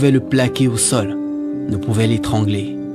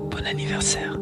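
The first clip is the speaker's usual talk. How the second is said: breathy whispered birthday wish; 9 dB quieter than usual. Acted. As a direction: whisper it close and soft.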